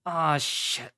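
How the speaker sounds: high-pitched groan of dismay. A narrator's reading. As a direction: groan as you remember too late. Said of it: "Adams groaned".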